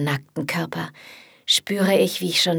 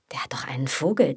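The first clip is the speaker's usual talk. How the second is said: scornful thought; 3 semitones lower, faster than usual. Acted.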